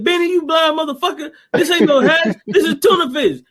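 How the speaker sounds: high-pitched, amused mock scolding; imitated scolding. [name]'s character berates [name] over the tuna, mock scolding. Acting out movie couple's quarrel.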